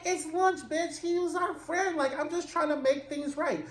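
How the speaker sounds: high-pitched sassy defense; high defensive friend. Acted. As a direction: squeal out the excuse, high. C Z defending herself to Slim.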